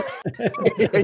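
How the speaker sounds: a high, far squealing giggle; high squealing laugh. Real, not acted. Laughing at the Four Seasons joke, high giggle.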